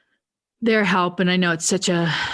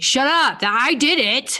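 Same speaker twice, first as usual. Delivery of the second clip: high, louder, slow teasing "shut up"; playful mock retort; 10 semitones higher, 5 dB louder, slower than usual. Daughter teasing her dad that she got him released, playful mock retort. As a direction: snap back at dad in fun.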